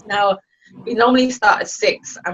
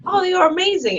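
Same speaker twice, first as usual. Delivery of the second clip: mock gushing praise; 11 semitones higher, 4 dB louder than usual. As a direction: imitate someone gushing compliments. Mimics praise she did not give.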